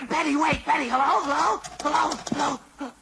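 high, insistent hellos into the phone; frantic calling. Acted. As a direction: call after her before the line goes dead. Calling into phone as she hangs up.

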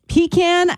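pecan as PEE-can, with a long ee; PEE-can pronunciation. Shown as a demonstration. Co-host repeats the word in question, pecan said as PEE-can. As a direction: hit the first syllable of pecan, PEE-can. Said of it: "I don't know if I should be saying pecan".

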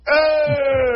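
high shouted hey, hamming up recognition; fake-excited hey. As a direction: shout a bright greeting as if you know him. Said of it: "I'm like".

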